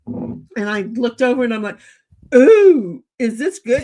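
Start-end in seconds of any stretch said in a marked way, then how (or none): 2.4-3.8 1 delighted ooh. Retold or imitated. Spotting eggnog in store.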